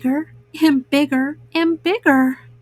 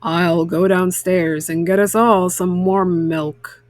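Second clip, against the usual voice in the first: lower, gentle fatherly voice; Papa Bear soothing the family back to bed, lower; 7 semitones lower than usual. A narrator's reading. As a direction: speak like a calm papa bear offering milk. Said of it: "said Papa".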